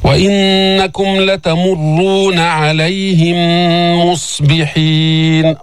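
slow chanted verse; Quran recitation. Shown as a demonstration. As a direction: recite evenly with held nasal. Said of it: "teacher recites verse".

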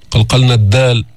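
ordinary talk, nothing marked

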